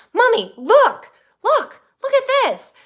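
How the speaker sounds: put-on high, loud little-kid nagging; child voice. Mom imitating daughter Zoe pestering her, high and loud. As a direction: imitate the toddler begging for attention. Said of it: "Zoe's like".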